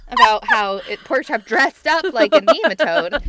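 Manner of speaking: loud, high-pitched frustrated explaining; hammed-up exasperated outburst; mock exasperation. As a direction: spell it out to a clueless friend, fed up. Acted exasperated correction of Skeeter.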